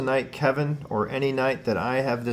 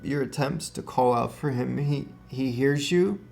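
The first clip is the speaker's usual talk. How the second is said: real emotion welling up, pitched higher; moved, higher-pitched, halting with long pauses; emotional; 3 semitones higher than usual. Real, not acted. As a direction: relay it while holding back tears. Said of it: "getting so emotional over this one".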